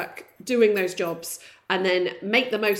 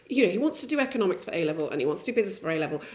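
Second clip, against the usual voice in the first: normal talking voice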